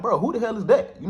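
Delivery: can't believe they're asking this; baffled. Retold or imitated. Denying knowing man to police.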